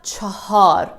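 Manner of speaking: CHAHAR slowly, in two syllables with an audible h; written form. Shown as a demonstration. Teacher showing learners the written word, chahar in full spelling pronunciation. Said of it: "It is written in this way".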